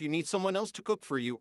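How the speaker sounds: ordinary talk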